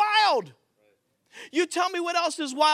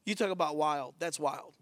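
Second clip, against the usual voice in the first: much softer, lower murmur of wonder; hushed awe; 9 semitones lower, 8 dB quieter than usual. Acted. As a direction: let it fall to a near-murmur. Hushed after the story.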